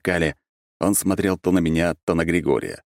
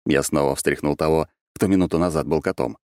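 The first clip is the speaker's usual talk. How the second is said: plain reading voice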